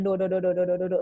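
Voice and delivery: every syllable on the same low note; flat monotone. Shown as a demonstration. Trainer mimicking a presenter without intonation, droning monotone. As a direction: drone like a toneless one-note scale. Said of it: "jangan kayak gitu".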